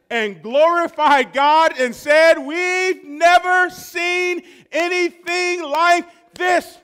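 high, loud, stretched-out preacher's shout; full-force preaching. Acted. Pastor rallying the congregation, loud and stretched out. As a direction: sell the wonder like a revival climax.

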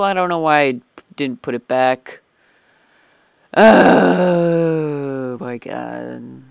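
long, loud, stretched oh my god; moaning in real despair; drawn-out groan. Real, not acted. Player groaning over losing cobble, loud and drawn out. Dismay at lost items.

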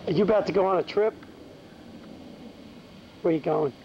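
ordinary talk, nothing marked

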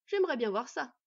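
loud, amused challenge; scoffing amusement. A narrator's reading. Bandit challenging the boy, scoffing. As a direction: dare him in full voice. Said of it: "s'esclaffa l'homme".

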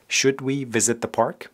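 usual speaking voice

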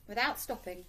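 ordinary delivery

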